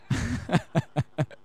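a high, amused burst of laughter; real laugh. Real, not acted. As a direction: laugh at the punchline.

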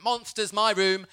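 put-on high, loud little-boy outburst; Ruben. Young son joining the monster check, high childlike shout. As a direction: pipe up like an overexcited small child. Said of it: "Ruben was joining in".

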